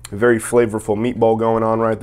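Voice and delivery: speaking between bites; talking while chewing. Real, not acted. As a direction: mouth full of sandwich. Seen 0: Bites sandwich just before; mouth looks full early in labelled words.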